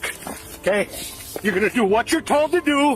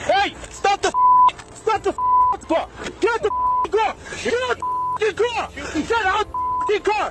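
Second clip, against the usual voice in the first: high, strained yell; shouting; 9 semitones higher than usual. Real, not acted. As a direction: bark the order at him. Officer yelling at driver.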